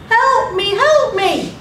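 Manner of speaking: high-pitched storyteller's cry for help; acted scream for help. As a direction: call out like a boy stranded on a slope. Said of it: "he screamed".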